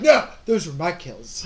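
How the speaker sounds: slow, mildly indignant in-character protest; acted snapping out. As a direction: play a sudden objection, drawn out. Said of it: "snap out and be like".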